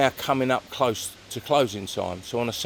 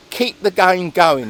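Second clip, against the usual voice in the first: loud, slow, higher command; retold order; 8 semitones higher, 9 dB louder, slower than usual. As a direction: insist the game goes on.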